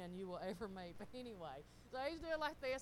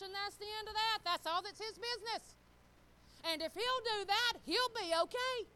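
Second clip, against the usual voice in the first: high, loud, emphatic preaching; full-force preaching; 9 semitones higher, 9 dB louder than usual. Real, not acted. Wife telling the congregation each spouse's job, preaching hard. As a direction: drive each point home like a preacher.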